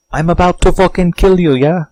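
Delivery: put-on high, loud, botched German villain accent; mock German accent, fast and loud; failed German accent. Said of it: "That wasn't German accent, was it?".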